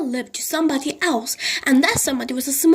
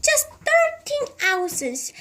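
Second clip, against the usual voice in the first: put-on doting old lady; 14 semitones higher, slower than usual. A narrator's reading. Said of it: "Missus Silver answered".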